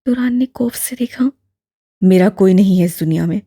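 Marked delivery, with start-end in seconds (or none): 2.0-3.4 low, louder, a touch of annoyance; lightly voiced young man, displeased; mildly resentful reply; irritated young man. A narrator's reading. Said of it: "नागावारी से जवाब दिया".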